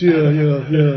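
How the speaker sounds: ordinary talk, nothing marked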